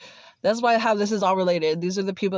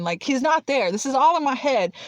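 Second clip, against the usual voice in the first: usual speaking voice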